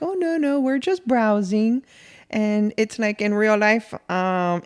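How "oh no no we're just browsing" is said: casual cover story. Acted. Shopper tells the worker no help is needed, airy brush-off. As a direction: wave off help, feigning innocence. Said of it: "And I'm like".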